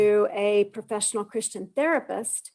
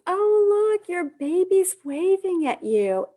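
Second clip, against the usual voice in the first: lightly voiced sonographer, high and sweet; a touch of the cooing sonographer; sonographer's voice; 9 semitones higher, slower than usual. Sonographer cooing to a mother at an ultrasound.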